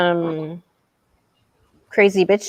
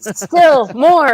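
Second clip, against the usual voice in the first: louder, higher mock push of more truth; mock forceful push; 7 semitones higher, 7 dB louder than usual. Pushing truth on detractors, mock forceful and loud. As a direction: shove it at them, playfully forceful. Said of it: "I'm like".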